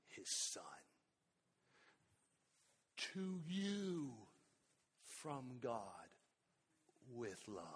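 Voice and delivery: very soft and low, almost breathless; hushed. Real, not acted. Preacher to congregation, a hushed whisper. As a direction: drop to a near whisper for the gift.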